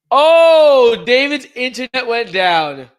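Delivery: high, loud exclaim at the news; sudden surprise. Real, not acted. Host suddenly realising why David vanished, surprised outburst. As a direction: blurt it out as it dawns, slowly.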